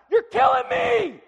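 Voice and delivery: high, drawn-out shout of exasperation; yelling out loud. Retold or imitated. Man retells missing his photo of a star, exasperated yell. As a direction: yell it slow and high, at full volume. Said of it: "and I yell out loud".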